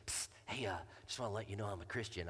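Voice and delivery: unvoiced psst then low, hushed and quick; whispered secret. A kid sneaking up at school, hushed whisper. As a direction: lean in and confide it under your breath.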